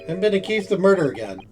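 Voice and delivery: ordinary delivery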